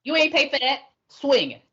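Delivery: laughing scold. Retold or imitated.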